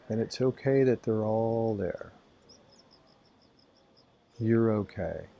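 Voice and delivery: calm, even voice with long silences; spacious, soothing meditation delivery; guided meditation. Acted. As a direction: guide their awareness through the body, leaving space. Leads body-awareness meditation.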